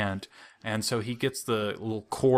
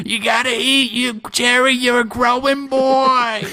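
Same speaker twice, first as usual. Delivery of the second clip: mom voice; 13 semitones higher, 8 dB louder than usual. Retold or imitated. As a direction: ham up the mother's line. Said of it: "That's the pull quote".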